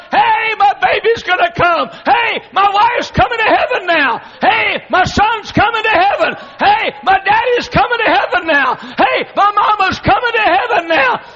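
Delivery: high, loud, laughing shouts of joy; joyful heavenly shout. Retold or imitated. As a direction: call out like family is finally coming home. Saints in heaven rejoicing.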